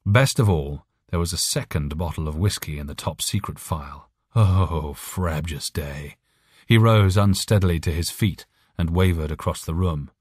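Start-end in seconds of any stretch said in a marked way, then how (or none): none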